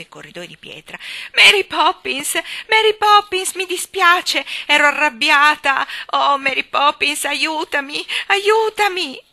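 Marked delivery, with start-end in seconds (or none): 1.3-9.2 high, wailing shouts for help; desperate screaming. Acted. Jane calling Mary Poppins to rescue her, screaming. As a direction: scream at full voice, stretching the name. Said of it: "cominciò a lanciare urla".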